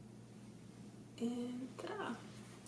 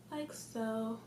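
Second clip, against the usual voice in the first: normal talking voice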